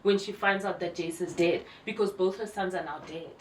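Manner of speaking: ordinary talk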